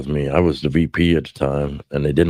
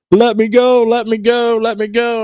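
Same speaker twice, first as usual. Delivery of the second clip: much higher, louder begging that fades; mock pleading; 5 dB louder than usual. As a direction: whine like a pinned man begging to be let go. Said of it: "and he's like".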